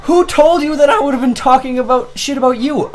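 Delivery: high, loud incredulous rant; indignant disbelief. Retold or imitated. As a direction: demand an answer in outraged disbelief. Retold indignant retort to accuser.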